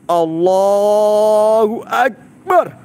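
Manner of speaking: long drawn-out, rising shout; invocation cry. Real, not acted. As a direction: stretch the words out hugely into a loud, high cry. Climax of ghost-gate incantation.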